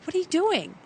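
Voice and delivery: high, incredulous question; retold protest. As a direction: replay an affronted reaction, pitch leaping up. Acting own protest at being poked.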